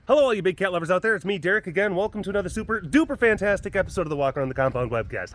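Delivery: fast, bouncy show-host welcome; host intro. Acted. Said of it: "mock show intro".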